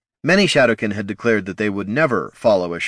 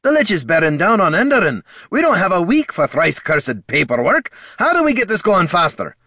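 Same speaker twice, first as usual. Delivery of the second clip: higher, louder, pressing dwarf voice; urgent dwarf chieftain; 11 semitones higher, 5 dB louder than usual. A narrator's reading. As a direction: push hard, the Lich is coming. Gorm urging, voiced.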